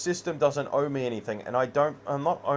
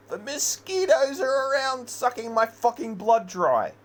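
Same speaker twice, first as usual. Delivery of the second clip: rising anguished rant; 7 semitones higher, slower than usual. Real, not acted. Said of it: "And I'm trying to feel that feeling".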